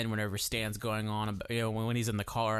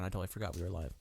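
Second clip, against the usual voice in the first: ordinary delivery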